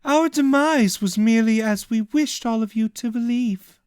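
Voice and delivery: smug, mildly mocking offer; cloaked figure. Cloaked figure reveals herself.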